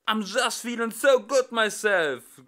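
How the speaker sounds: high, loud bawled singing; bawling crowd. Acted. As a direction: belt it back like a rowdy crowd. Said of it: "grölte der Saal zurück".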